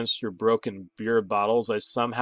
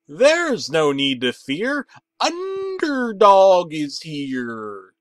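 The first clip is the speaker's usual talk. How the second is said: very slow, much louder, higher heroic proclamation; triumphant exclamation; 6 semitones higher, 7 dB louder, much slower than usual. Acted. Drunk narrator announcing himself as Underdog, a slow triumphant proclamation. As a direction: announce it like a cartoon superhero, drawing out every word. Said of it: "I triumphantly exclaimed".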